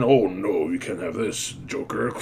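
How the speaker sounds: low, quiet, hushed hero voice; Batman voice. Retold or imitated. Reviewer voicing Batman as he saves the car, low and hushed. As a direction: drop low and hush it like Batman.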